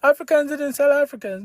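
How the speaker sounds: high mocking protest, pitched well up; a squeaky, hammed-up denial. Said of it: "I will not say".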